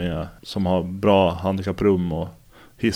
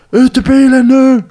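much higher, much louder, slow furious bellow; retold cop screaming, each word hammered slowly; imitating an angry cop yelling; 11 dB louder than usual. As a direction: play the cop losing patience, full yell. Cop repeats order, angrier.

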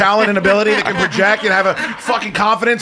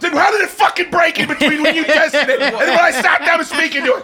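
furious rant; 10 semitones higher than usual. Real, not acted. Host yelling at crew over a broken mic, furious shout.

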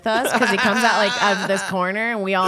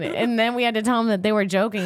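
ordinary talk

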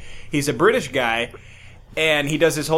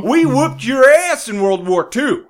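higher, louder hammed-up flag-waving yell; mock patriot rant; 6 semitones higher, 7 dB louder than usual. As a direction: bellow like a cartoon patriot.

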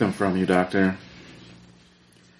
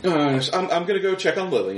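high, light wife excusing herself; wife's voice; 10 semitones higher than usual. Acted. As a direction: chirp it and hurry off.

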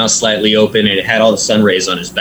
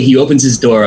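ordinary delivery

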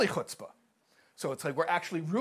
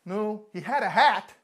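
higher, louder, offended complaint to heaven; indignant grandmother; 8 semitones higher, 8 dB louder than usual. Acted. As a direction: hit "hat" like a grievance. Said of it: "and she says".

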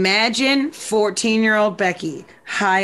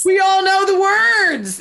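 singer's call; 11 semitones higher, 4 dB louder, slower than usual. Retold or imitated. Singer's line at concert.

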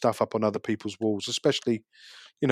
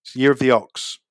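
put-on light Scottish accent repeat. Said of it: "Scottish for".

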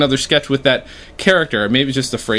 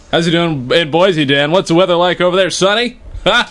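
bright, higher anchor voice calling out to the field reporter; mock anchor; 5 semitones higher than usual. Anchor calling to weatherman Dan, mock newscast voice. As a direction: toss it to the weatherman like a TV host.

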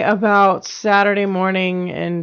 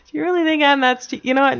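ordinary talk

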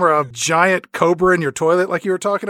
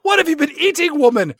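much higher, shouted disgust; 10 semitones higher than usual. Pretend. Bond yelling at M about the smell, hammed-up disgust. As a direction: yell in mock revulsion at the stink.

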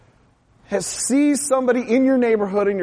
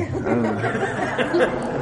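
laughing; 11 semitones lower, much slower than usual. Real, not acted. Laughing at himself mid-prayer.

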